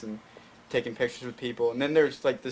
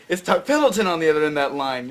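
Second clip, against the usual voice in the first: louder, higher, star-struck gush; the fan overhears a call from Dennis Quaid and gushes, loud and high; starstruck excitement; 4 semitones higher, 8 dB louder, faster than usual. Retold or imitated.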